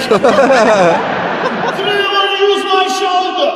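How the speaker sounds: high, slow, laughing mock voice; a TSA agent answering his boss, hammed up high and slow; goofy mock agent. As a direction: stretch it out high and silly, laughing. Acted TSA guy answer.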